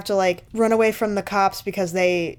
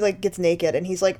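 ordinary delivery

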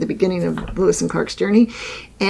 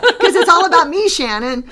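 very high, loud, laughing protest; mock self-centred; 11 semitones higher, 5 dB louder than usual. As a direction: jokingly make it all about yourself, cracking up.

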